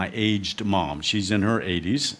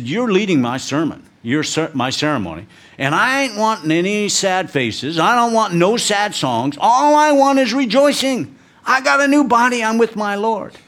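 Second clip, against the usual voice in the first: put-on much higher, louder, worked-up old mother's voice; mom scolding; 10 semitones higher, 5 dB louder than usual. Aged mom rebuking her son for planning to mourn, high and loud. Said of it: "And she said".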